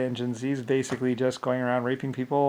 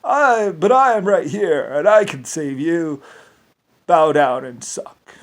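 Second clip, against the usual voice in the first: higher, slow, louder mock tyrant voice; mocking villain; 4 semitones higher, 9 dB louder, slower than usual. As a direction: ham up a self-important warlord, slowly. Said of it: "And then he goes".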